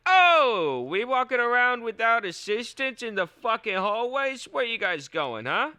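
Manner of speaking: high, loud streetwise Italian heckling; tough Italian guy. Pretend. Italian guy at Hogwarts challenging students in the hallway, loud wiseguy heckle. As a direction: bark it like a wiseguy hall monitor.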